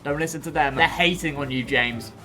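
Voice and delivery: soft reassuring. Pretend. Comforting the struggling train.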